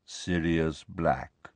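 very slow, hushed naming; grave, low, drawn out; mournful. A narrator's reading. Ghost names Harry's lost one, mournful. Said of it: "looked mournfully at Harry".

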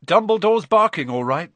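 proud, emphatic burst. A narrator's reading. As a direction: crow it proudly, punching the first words. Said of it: "said Ron proudly".

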